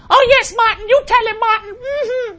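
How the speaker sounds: very high falsetto whooping, very loud; hammed-up shrieking woman in the audience; mock crowd screaming. Host imitates a woman in the crowd cheering, mock scream. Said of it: "would scream out".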